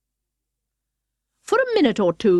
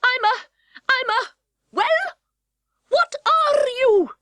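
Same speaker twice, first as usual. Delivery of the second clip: put-on high, hesitant girl voice; a flustered little girl, pitched up; young Alice.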